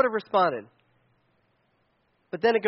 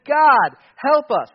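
loud plea; 7 semitones higher, 10 dB louder, much slower than usual. Retold or imitated. Israelites crying out to God.

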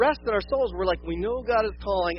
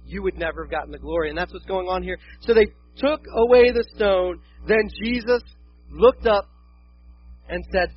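usual speaking voice

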